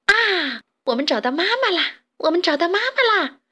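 put-on high, gleeful child-like cry; delighted tadpoles in high voices; overjoyed. A narrator's reading. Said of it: "高兴地在水里翻起跟斗来".

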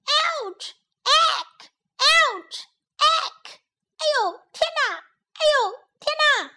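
very high cartoon yelps of pain; squealing wasps. Wasps being beaten by bees, high squealing yelps. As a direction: squeak like little wasps getting hit.

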